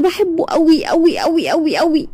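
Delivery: high, slow, swooning repeat; swooning over her crush, high and slow; lovestruck gushing. A narrator's reading. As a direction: stretch each word dreamily like a smitten girl. Girl gushing about her crush.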